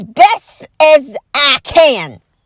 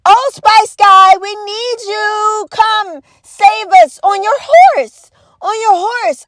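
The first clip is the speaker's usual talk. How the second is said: slow, higher swooning call; mock swooning plea; slow dramatic plea; 6 semitones higher, slower than usual. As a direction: beg a hero to ride in. Pleading to Old Spice guy.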